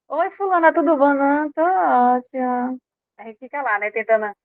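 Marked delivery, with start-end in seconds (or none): 0.1-1.1 high, bright sing-song hello that sounds put on; a forced, cheery hello to someone you can't stand; fake sweet greeting. 1.1-2.8 forced fine. Retold or imitated. Pretending to be fine.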